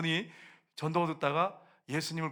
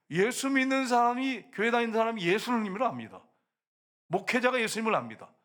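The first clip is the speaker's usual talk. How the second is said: raised, high-pitched cry of rebuke; loud preaching; 7 semitones higher, 5 dB louder than usual. Real, not acted. Preacher decrying churchgoers who don't believe, loud and high. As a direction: shout it out to wake the congregation. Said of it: "소리를 좀 크게 지르는 것은".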